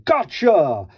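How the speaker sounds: loud pouncing shout; a gleeful loud gotcha; dinosaur yell. Acted. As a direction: yell it like springing a trap. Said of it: "a dinosaur yelling".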